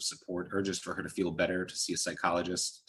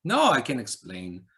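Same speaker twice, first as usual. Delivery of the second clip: sighing; 4 semitones higher, 7 dB louder, slower than usual. A narrator's reading. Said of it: "he sighed deeply".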